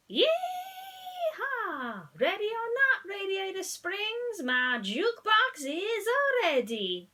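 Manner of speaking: high, loud country yee-haw. A narrator's reading. Tow truck whooping to the whole town, loud country drawl. Mater calls out his jukebox.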